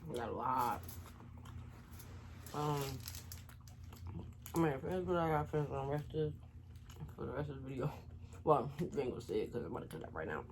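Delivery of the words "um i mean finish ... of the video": ordinary talk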